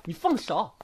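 high, sharp command to let go; sharp protest. Acted. Xia Rui tells Han Miao to let go, sharply. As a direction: snap at him to let go. Pulling free from his grip.

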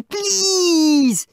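high, drawn-out begging whine; loud wheedling plea; pleading. A narrator's reading. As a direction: beg like a desperate child. Boy begs for toy.

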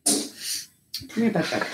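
whispering to a pet; soft coaxing whisper. Real, not acted. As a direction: coax the pet in a hush.

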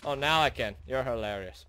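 ordinary delivery

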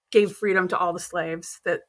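ordinary talk, nothing marked

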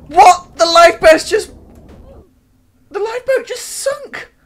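high, loud shocked cry; panicked shriek. Real, not acted. Gamer watching the lifeboat sink, shrieks in panic. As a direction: shriek as it all goes under. Lifeboat sinks under her.

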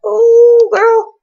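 high, loud drawn-out exclamation; surprised. Real, not acted. Player reacting to her Sim's behaviour, high loud surprise. Sim stops eating.

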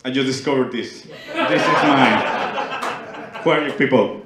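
loud, emphatic claim-staking; hammed-up bold declaration; mock colonizer. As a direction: proclaim it loudly like a discoverer staking a claim. Acting out colonizer claiming new land.

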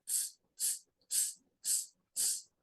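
very soft ts ts calling for quiet; soft ts hush. Shown as a demonstration. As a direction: tsk softly to ask for silence. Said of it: "Ми запросимо тишу".

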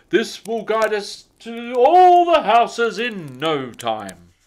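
rally the elves cheerfully; jolly Santa. A narrator's reading. Santa telling the elves to load up, jolly and loud.

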